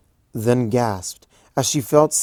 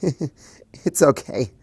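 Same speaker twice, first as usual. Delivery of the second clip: slow, giggly reply; laughing through the words; 4 semitones higher, 3 dB louder, much slower than usual. A narrator's reading. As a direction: laugh it out fondly. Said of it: "laughed Twilight".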